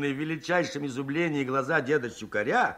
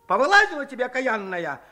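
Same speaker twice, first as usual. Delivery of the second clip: angry scolding; 6 semitones higher, faster than usual. Acted. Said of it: "обрушился на бабу дед Щукарь".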